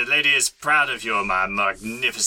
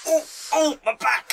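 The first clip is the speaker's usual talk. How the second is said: much higher Yorkshire "oh, oh, my back!"; a peasant from Yorkshire straining his back; Yorkshire groan. Acted. Lifting the orc head, groaning in Yorkshire. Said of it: "I don't know why I go Yorkshire".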